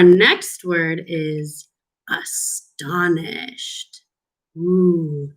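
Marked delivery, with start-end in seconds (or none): 2.1-4.0 whisper. Shown as a demonstration. Teacher introduces new vocabulary word.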